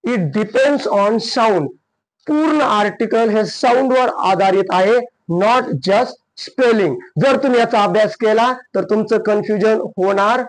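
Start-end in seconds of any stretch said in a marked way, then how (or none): 0.0-1.7 it depends on sound, drawn out slowly with weight on depends; slow stressed teaching rule; slow emphatic rule. Shown as a demonstration. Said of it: "म्हणून लक्षात ठेवा". 5.4-7.0 it depends on sound, drawn out slowly with weight on depends; slow stressed teaching rule; slow emphatic rule. Shown as a demonstration. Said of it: "म्हणून लक्षात ठेवा".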